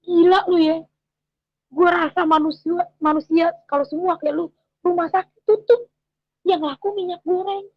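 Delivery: high, loud mocking husband; imitating the husband's scoffing joke. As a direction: tease her like her husband did. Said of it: "Suami saya bilang begini".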